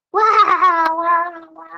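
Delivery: loud, high hammed-up bawling wail; a jokey imitation of loud crying; mock crying wail. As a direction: wail like a sobbing grown man, overdone. Man crying at the station.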